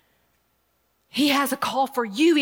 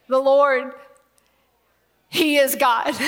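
loud proclamation; 4 dB louder, slower than usual. Acted. Preacher voices the people crying out, a loud tearful proclamation. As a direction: proclaim "The Lord, he is God" loudly, voice catching. Then proclaimed aloud, near tears.

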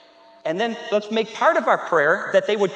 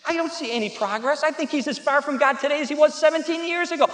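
ordinary talk, nothing marked

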